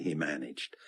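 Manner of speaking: plain reading voice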